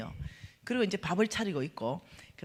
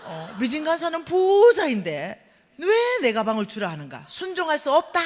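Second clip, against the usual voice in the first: higher, loud hammed-up lament; dramatic letter reading; 5 semitones higher, 7 dB louder than usual. Reading the gift letter aloud, hammed-up exasperation. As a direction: ham up the letter's complaint. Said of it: "편지를 내가 딱 읽으니까".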